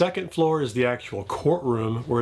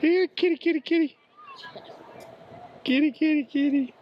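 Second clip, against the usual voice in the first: high sing-song kitty call; calling a cat; 13 semitones higher than usual. Real, not acted. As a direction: coax the cat out.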